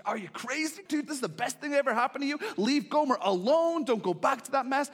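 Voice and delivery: high, loud, fast mocking friend voice; incredulous friends. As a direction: scoff at him like a buddy who thinks he's lost it. Townspeople mock Hosea.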